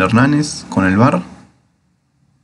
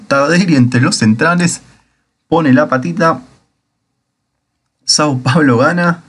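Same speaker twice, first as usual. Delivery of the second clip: breakneck goal commentary; 7 semitones higher, slower than usual. Real, not acted. Own team scores a goal.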